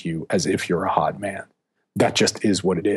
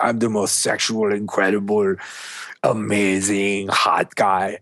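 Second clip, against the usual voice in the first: put-on French accent. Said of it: "this thick French accent and he was like".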